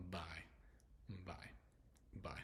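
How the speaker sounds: "buy" said singly with a clear eye vowel. Shown as a demonstration. Teacher showing Y sounding like I, spaced demonstration. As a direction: repeat "buy" with spaces between. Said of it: "where Y sounds like an I".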